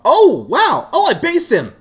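high, loud whoop of surprise; real gamer's excitement; excited outburst. Real, not acted. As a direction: blurt it out loud and high, thrilled. Thrilled by a lucky hit.

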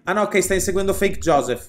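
usual speaking voice

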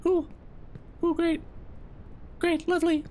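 tiny, very high reaction; high-pitched voice. Real, not acted. Player nervously reassuring himself mid-climb, high-pitched. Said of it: "my voice is progressively getting higher".

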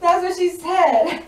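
slow, high, loud sassy punchline; emphatic punchline. Real, not acted. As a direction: land it slowly, loud and high, full of sass. Punchline after reading quote.

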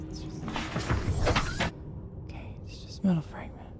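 hushed, quick whisper to herself. Real, not acted. Nervous diver whispering to herself, hushed. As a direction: breathe it out almost silently.

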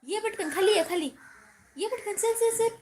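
loud, high call to come quickly; eager calling. Real, not acted. Calling child down to cat.

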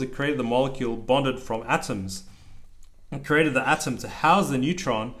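steady rhythmic picture-book reading; reading aloud to child. A narrator's reading. Dad reading a rhyming book to his child, lilting read-aloud. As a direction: read the rhyme out to your kid.

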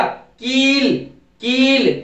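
"कील" with a stretched ee; long E. Shown as a demonstration. Teacher models the word, long "कील". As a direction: draw out the vowel in "कील". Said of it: "इसको लंबा बोलना है".

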